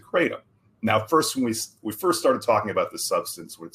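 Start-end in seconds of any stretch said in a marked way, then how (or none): 0.0-0.4 KRATOM with long A, KRAY-tum; alternative pronunciation. Shown as a demonstration. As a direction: say kratom with the A of day. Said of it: "as some like to pronounce it".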